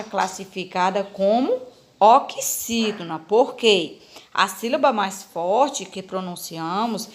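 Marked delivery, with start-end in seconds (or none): none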